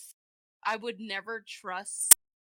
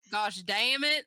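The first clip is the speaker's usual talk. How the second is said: Southern drawl on damn it; lightly drawled Southern swear; Southern accent; 7 dB louder, slower than usual. Retold or imitated. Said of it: "were you trying to do a Southern accent there?".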